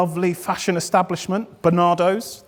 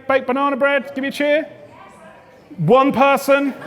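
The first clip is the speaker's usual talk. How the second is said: usual speaking voice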